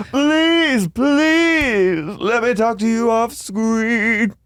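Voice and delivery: high, slow begging impression; pleading Heather. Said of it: "And Heather goes".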